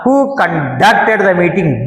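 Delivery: conducted said conDUCTed, second syllable hit; slow demo, lean on DUCT in conducted; stress on conDUCTed. Shown as a demonstration. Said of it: "డి మీద stress చేసినప్పుడు". (conducted లో DUCT మీద ఒత్తి, నెమ్మదిగా)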